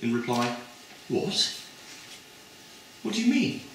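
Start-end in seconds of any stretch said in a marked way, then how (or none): 1.1-3.6 high, puzzled quoted voice; a lightly voiced girl caught off guard; squeaky puzzled student, no accent; surprised student. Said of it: "And she said, in reply".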